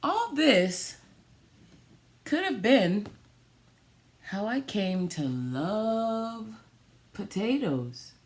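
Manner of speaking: tender story ending. Acted. Story's closing line.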